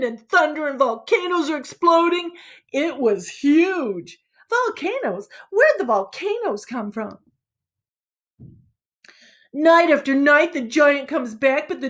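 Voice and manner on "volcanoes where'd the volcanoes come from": high skeptical surprise; can't believe what was just said; disbelieving rising squeak; incredulous. Acted. Other kid objects to story.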